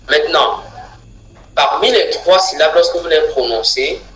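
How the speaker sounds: usual speaking voice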